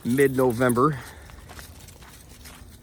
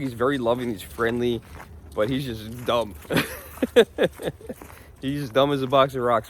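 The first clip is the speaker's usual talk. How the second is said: normal talking voice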